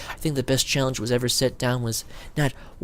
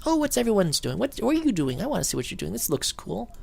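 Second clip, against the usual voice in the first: much higher, eager curious gamer voice; excited friends; 9 semitones higher than usual. Retold or imitated. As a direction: pitch way up like a kid spotting something cool. Curious friends drifting over.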